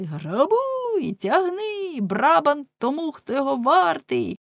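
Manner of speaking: rabble yelling. Acted. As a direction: egg the crowd on to loot. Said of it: "невідомі кричали". (підбурювачі дико кричать до натовпу)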